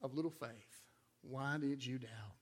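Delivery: soft whispered rebuke; gentle whisper. Retold or imitated. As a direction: hush it like a tender reproach. Jesus gently rebukes Peter.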